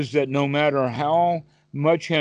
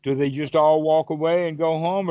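ordinary talk

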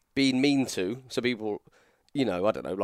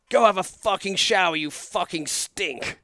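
higher, harsh mock bark from Hardcore Holly; quoting Hardcore Holly; 8 semitones higher, slower than usual. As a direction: snap it at him like a locker room bully. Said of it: "He goes".

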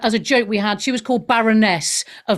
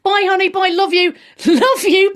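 gushing goodbye; 8 semitones higher, 3 dB louder than usual. Real, not acted. Gushing goodbye, higher and louder.

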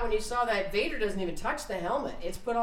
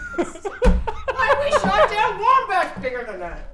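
high, slow, laughing through it; cracking up mid-line; 11 semitones higher, 7 dB louder, much slower than usual. Retold or imitated. Mock Luke line, laughing.

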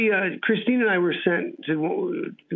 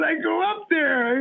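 high, drawn-out exclamation; retold excited reply; 12 semitones higher, slower than usual. Actor answers astonished colleague about hometown, excited and high. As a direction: stretch it out high, amazed at the coincidence.

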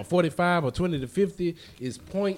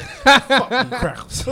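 slow, louder mocking jab with a laugh; mocking man; 8 semitones higher, 7 dB louder, much slower than usual. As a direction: drag it out, laughing at them. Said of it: "he's like".

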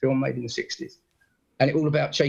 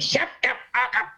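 mock angry snap; 10 semitones higher than usual. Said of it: "And he went".

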